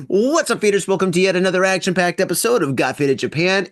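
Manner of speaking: upbeat host greeting; podcast intro. Acted. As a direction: welcome the audience like a show host.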